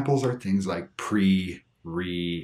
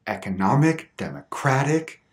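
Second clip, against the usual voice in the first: "economic" stressed on NOM, "democratic" on CRAT; stress before -ic; 3 semitones higher than usual. Shown as a demonstration. Teacher showing suffix rule, "economic, democratic" stressed just before -ic. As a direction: punch the syllable right before -ic in "economic, democratic". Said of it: "has the stress immediately before".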